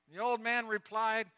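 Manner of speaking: gripping sermon story; dramatic storytelling. A narrator's reading. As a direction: tell the drowning tale at full pitch. Storytelling at rising intensity.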